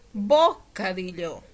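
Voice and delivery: misplaced first-syllable stress, BO-cadillo. Shown as a demonstration. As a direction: punch BO in "bocadillo", slowly. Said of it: "No es lo mismo decir".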